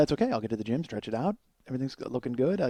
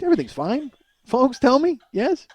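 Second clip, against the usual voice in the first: high-pitched plea for reassurance; mock anxious pleading; 11 semitones higher, 4 dB louder than usual. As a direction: half-jokingly beg the listeners to agree. Comically begs listeners for reassurance.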